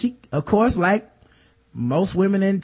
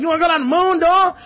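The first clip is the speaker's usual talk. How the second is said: mock threatening screech; 10 semitones higher, 4 dB louder, faster than usual. Lysa threatening at the moon door.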